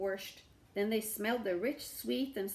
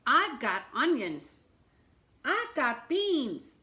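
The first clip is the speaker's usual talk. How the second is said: townsfolk calling out; 4 semitones higher, 6 dB louder, slower than usual. A narrator's reading. Townsfolk offering vegetables.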